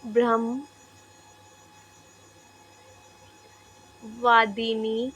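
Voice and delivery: very slow, drawn-out dictation pace. Shown as a demonstration. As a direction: spell it out word by word for students to write. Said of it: "teacher dictating notes".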